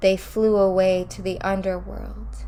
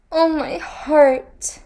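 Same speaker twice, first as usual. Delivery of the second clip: much higher, louder, slow moan; 9 semitones higher, 9 dB louder, slower than usual. A narrator's reading. The Queen of Death moaning over her heart. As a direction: let the moan swell out of her. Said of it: "moaned the Queen of Death".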